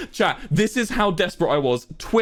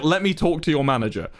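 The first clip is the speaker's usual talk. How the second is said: ordinary talk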